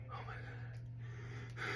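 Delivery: breathless, barely voiced oh my God; whispered horror at the funeral; shocked whisper. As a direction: gasp it out under your breath. Imagined mourners seeing dead man.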